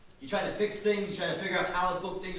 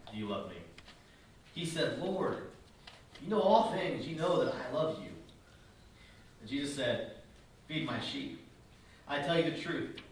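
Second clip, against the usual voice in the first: scripture reading; 3 semitones lower, 3 dB quieter than usual. Acted. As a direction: read the passage gently and softly.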